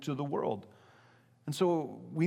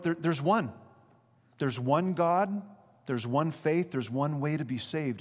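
ordinary talk, nothing marked

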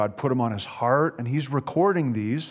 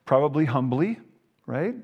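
ordinary delivery

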